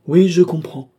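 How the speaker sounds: oui haut et fort, élan soudain; démarrer fort, d'un coup; oui lancé avec élan. A narrator's reading. Philippe compatissant. (Philippe s'anime, fort et haut)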